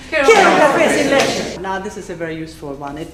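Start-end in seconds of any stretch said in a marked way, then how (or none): 0.1-1.6 high, rapid-fire drill line; even faster. Shown as a demonstration. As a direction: race through it without a gap.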